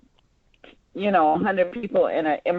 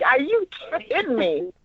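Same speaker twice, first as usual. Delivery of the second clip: disbelief; 4 semitones higher than usual. Pretend. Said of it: "and I'm like".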